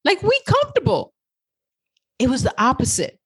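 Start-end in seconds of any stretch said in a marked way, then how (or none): 0.0-1.1 high, fast mocking inner voice; brain voice. Her brain scolding her about leaving a safe job, high and fast. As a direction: squeal it like a panicked brain. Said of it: "my brain was like".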